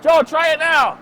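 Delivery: high, loud call to a coworker; calling out loudly. Acted. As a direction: shout the line across the room.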